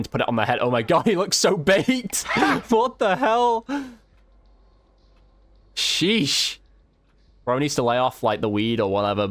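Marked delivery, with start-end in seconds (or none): none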